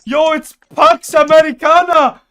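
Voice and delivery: loud, high excited yell; excited shout. Real, not acted. Excited game discovery.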